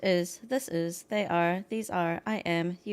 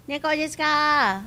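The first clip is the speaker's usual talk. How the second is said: louder, higher second try; loud confident answer; 7 semitones higher, 8 dB louder than usual. Shown as a demonstration. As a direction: say it out proudly. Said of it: "how would you say".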